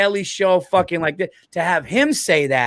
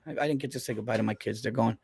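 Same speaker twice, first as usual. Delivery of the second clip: hushed confession; 7 semitones lower, 9 dB quieter than usual. Real, not acted. Checking kids left before confessing.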